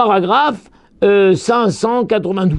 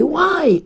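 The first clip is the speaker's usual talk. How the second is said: higher why; mock cry of pain; 8 semitones higher than usual. Rabbi denying his cry, quoting a higher why. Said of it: "je n'ai pas crié".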